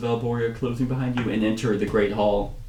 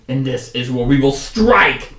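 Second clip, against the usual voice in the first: slow build to a loud final word; rallying commander; 6 dB louder, slower than usual. Acted. Commander announcing the attack point, building loud and resolute. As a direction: drive up to a punched STRIKE.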